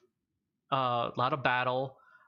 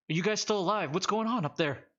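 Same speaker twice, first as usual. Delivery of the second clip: higher-pitched anxious call upward; 6 semitones higher than usual. Acted. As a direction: call up to someone on a cliff, voice raised in pitch. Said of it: "Sinjin calls out to you".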